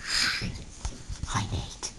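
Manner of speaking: soft breathy whisper greeting. Real, not acted. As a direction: whisper the hello. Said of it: "Why are we whispering?".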